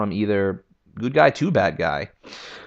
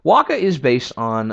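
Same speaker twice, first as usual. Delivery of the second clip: ordinary delivery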